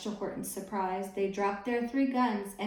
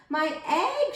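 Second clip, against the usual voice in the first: high, loud cry of the elephant; shout; 7 semitones higher, 4 dB louder than usual. Acted. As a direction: call out in alarm. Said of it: "shouted Horton".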